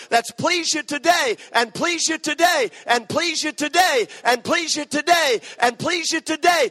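high, loud climactic preaching; rising sermon fervor, loud and high; preaching at full force. Acted. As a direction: build the altar call to a shout.

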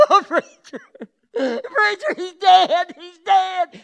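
high, loud wailing woman's voice; imitated crying wife, very high and loud; hysterical wife. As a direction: play the sobbing wife on the phone. Wife's frantic phone call mimicked.

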